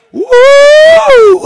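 high, loud revival "woo"; excited whoop. Real, not acted. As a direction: let out a soaring whoop of joy.

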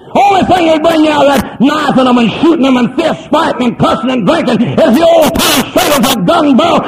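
high, loud hollering sermon; shouted revival preaching; fiery preaching. Acted. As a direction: preach it at full force to the congregation. Preaching at full force.

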